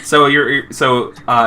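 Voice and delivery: normal talking voice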